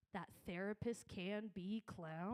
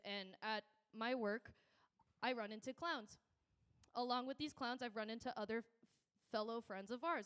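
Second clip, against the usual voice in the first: ordinary delivery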